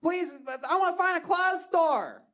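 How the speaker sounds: high mock yell in thick Baltimore accent; hammed-up drunk Baltimore accent; drunken Baltimore yelling. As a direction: holler at your phone in broad Baltimore. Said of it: "yelling at Siri".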